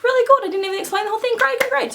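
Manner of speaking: fast, high, loud imitation of delighted praise; hammed-up excited teacher; impressed teacher. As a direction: gush over the pupil at high pitch. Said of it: "And she's like".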